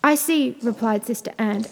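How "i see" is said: higher-pitched reply; raised, announcing voice; calling down. A narrator's reading. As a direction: call down from the tower. Sister calls down from tower.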